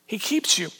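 whispered promise. Real, not acted. Pastor whispering reassurance to the congregation. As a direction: breathe it out as a secret comfort. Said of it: "tender repeat, whispered".

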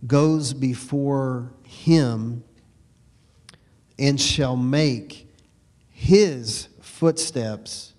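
drawn out, word by word, with long pauses; slow emphatic reading. Acted. Preacher rereading the verse to the congregation, very slow. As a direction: let each word land, slowly.

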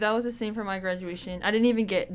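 ordinary delivery

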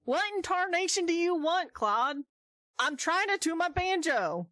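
put-on high twangy old-timer cowboy voice; old prospector. [name] answers Claude in character.

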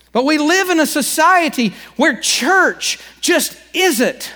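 preaching. Real, not acted. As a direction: preach it out with fire. Preaching at full force.

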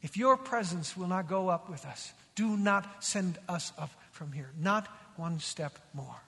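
low, soft, deliberate plea; quiet, weighty recitation; solemn quotation. Retold or imitated. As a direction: let each phrase settle gravely, slowing at the end. Preacher solemnly repeats the key text.

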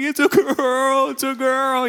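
put-on high, slow, joyful cry of it's a girl; overjoyed announcement. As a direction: announce it half in tears of joy. New father announcing daughter.

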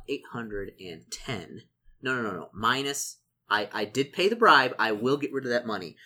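ordinary talk, nothing marked

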